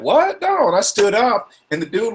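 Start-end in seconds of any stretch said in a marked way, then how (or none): 0.0-0.7 incredulous. Retold or imitated. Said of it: "I was like".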